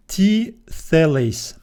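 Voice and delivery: slow, careful reading of Greek. Shown as a demonstration. Teacher reading a Bible verse slowly to students. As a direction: read each syllable slowly for students. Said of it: "Let's read".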